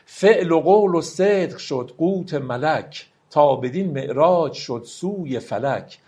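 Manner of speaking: slow, solemn verse reading; slow poem recital. Acted. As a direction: let each line of the poem ring out slowly. Recites Rumi verse slowly. (دکلمهٔ آرام و باوقار شعر مولانا)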